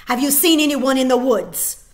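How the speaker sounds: loud, hard demand; barked question. Acted. Soldier barking at the girl, loud. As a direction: bark it at her like an order. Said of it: "the soldier barked the question at her".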